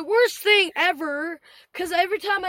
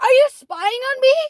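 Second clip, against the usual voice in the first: loud, high mock outrage; retold outraged accusation; 7 semitones higher, 9 dB louder than usual. Retold customer accuses manager over security cameras, outraged. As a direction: act out an affronted customer raising her voice.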